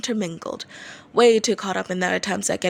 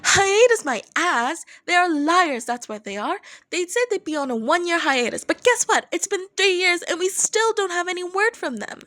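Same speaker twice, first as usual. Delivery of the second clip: complaining; 10 semitones higher than usual. A narrator's reading. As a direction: vent like a let-down fan. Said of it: "Higuchi complained".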